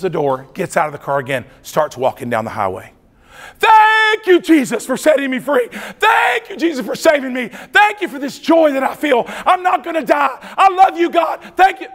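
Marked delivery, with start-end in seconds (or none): 3.6-11.9 very high, loud praise shouts; shouted praise. Retold or imitated. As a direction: cry thanks to God at full voice. Man praising on the highway.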